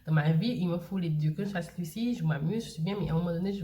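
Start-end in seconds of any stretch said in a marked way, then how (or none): none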